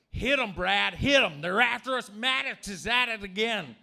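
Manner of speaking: loud, high frantic shout; mock kids yelling. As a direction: urge Brad on like panicked kids. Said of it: "biological kids were like".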